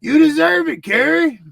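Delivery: loud, high Texas hillbilly heckle; Texas hillbilly accent. Retold or imitated. Ringside fan yelling at Kerry in a loud Texas accent. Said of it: "my Texas accent".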